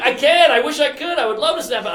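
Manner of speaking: high, loud pleading that eases off; mock desperation. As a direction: protest helplessly, acting out desperation. Desperate answer to manager, retold.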